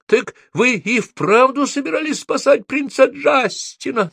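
very high incredulous squeal. A narrator's reading. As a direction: squeal the question, pitch way up. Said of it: "завизжала Софи". (визжать высоким голосом)

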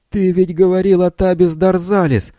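high loud accusing shout; yelling. A narrator's reading. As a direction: shout it at a lying friend. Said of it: "закричал Билл на Блэке".

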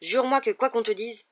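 insistent demand for a promise; urgent insistence. A narrator's reading. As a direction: press for the oath before time runs out. Father urgently presses for a promise.

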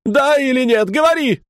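mother screaming at daughter for yes or no; shrill shout. Retold or imitated. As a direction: force the answer out of her. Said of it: "кричит жена".

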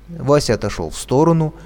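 plain reading voice

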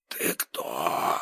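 throaty hissed whisper; guttural hiss. Acted. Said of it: "прошипел он гортанно".

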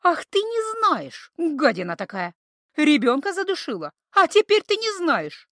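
slow, high, loud angry reproach; outraged scolding; furious accusation. A narrator's reading. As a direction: lash out at the creature.

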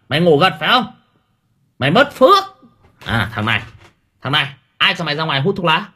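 high, loud nagging; scolding temple lady. Retold or imitated. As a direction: scold the dozing kids, shriller each time. Lady scolding sleepy relatives.

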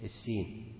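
normal talking voice

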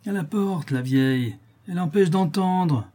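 high-pitched jeering call; mirthful heckling. A narrator's reading. Lame boy mocks the old woman from the stairs, high mirthful jeer. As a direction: heckle her as if it's all a show. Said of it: "dit le petit boiteux en éclatant de rire".

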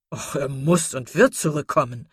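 mild, emphatic exclamation; calling out. A narrator's reading. Sir John insists Brandon will come back, exclaiming. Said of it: "rief Sir John".

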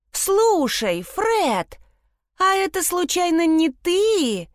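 teasing shout across the room; calling out. A narrator's reading. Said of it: "крикнула Мелани с кровати".

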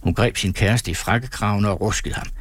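plain reading voice

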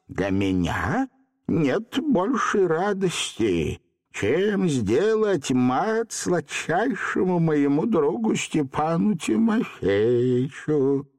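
very slow, high, stately character voice; sedate old Hottabych. A narrator's reading. Hottabych absorbed in his chess game, sedate. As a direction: savour every word unhurriedly. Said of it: "степенно ответил Хаттабыч".

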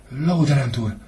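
put-on a small boy answering by rote in class; timid child reciting. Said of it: "rebegte a gyermek gondolkodás nélkül". (félénk gyerek, iskolásan felmondva)

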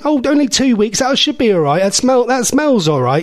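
high-pitched nan brushing off doubts; reassuring nanny. Retold or imitated. Nanny vouching for old sausage rolls, high reassuring voice. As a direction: wave away the worry in a high old-nan voice.

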